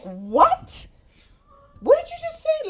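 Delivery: shocked, high disbelieving what did you just say; outraged what. Retold or imitated. Telling Rick off on the bus, shocked and outraged. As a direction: react like you can't believe your ears. Outraged reply to Rick's remark.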